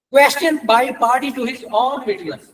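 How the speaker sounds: deliberate, measured legal recitation; slow statute reading. Acted. Lecturer reads section 48 to law students, slow and deliberate. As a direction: read the law out slowly, word by word.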